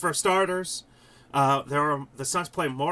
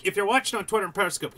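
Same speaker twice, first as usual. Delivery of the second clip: ordinary talk, nothing marked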